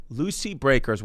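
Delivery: ordinary delivery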